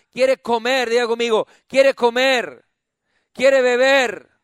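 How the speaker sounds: loud climactic preaching; preaching at full force. Real, not acted. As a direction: drive it home to the congregation. Predicación a pleno volumen. (predicación a todo pulmón)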